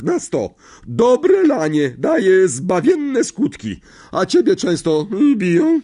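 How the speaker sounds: put-on high, loud, overbearing old aunt; a big domineering aunt who loves her dog; bossy aunt. A narrator's reading. Marge on beatings. (władcza ciotka, wysoki głos)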